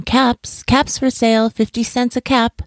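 high, sing-out hawker's call; peddler's street cry. A narrator's reading. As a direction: call out your wares to the street. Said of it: "walked down the street calling".

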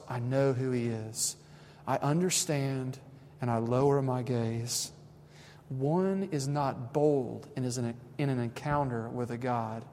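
soft, slow reverent reading; story reading. Acted. Pastor winding down by reading a moving story, soft and slow. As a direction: read it hushed, like a holy moment.

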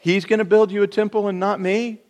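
hammed-up jealous David; mock indignant David. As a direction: scoff at the unfairness. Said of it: "He didn't say".

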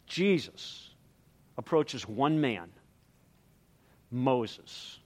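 ordinary delivery

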